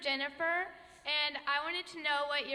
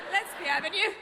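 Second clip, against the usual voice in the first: laughing Irish accent attempt; 5 semitones higher, 7 dB louder, faster than usual. Shown as a demonstration. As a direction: try an Irish lilt, laughing. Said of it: "do the Irish accent".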